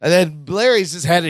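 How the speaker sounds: ordinary talk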